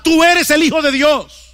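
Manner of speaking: shouting. Acted. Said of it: "que le gritaban".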